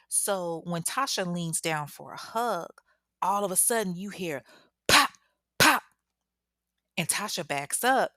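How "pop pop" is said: imitated gunshots. Gunshots mimicked in story.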